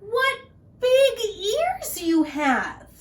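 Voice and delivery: high, slow and loud wonder; amazed little girl. Acted. Little Red voiced.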